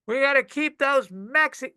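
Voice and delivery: high-pitched imitation of a ranting woman; mock yelling. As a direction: imitate someone yelling across a bar. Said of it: "at the top of her lungs".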